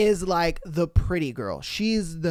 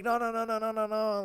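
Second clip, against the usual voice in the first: high, softer run of protests; hurried backpedalling in a raised pitch; imitating a flustered boy; frantic denial; 5 semitones higher, 5 dB quieter than usual. Retold boy denies it once more.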